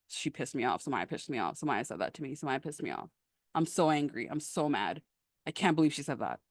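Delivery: low, hushed angry rumination; brooding mutter. Acted. Coach acting out a student stewing over Samaya's remark, muttered brooding.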